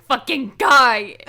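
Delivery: slow, very loud exclamation; pretend-exasperated catchphrase shout; mock argument yell. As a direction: draw out GUY in a high yell. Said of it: "so I can be like".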